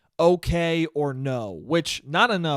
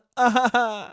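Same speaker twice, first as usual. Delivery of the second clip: short, loud, high laugh; real laugh; 7 semitones higher, 4 dB louder than usual. Real, not acted. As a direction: burst out laughing at the absurdity. Laughs at the absurd imitation.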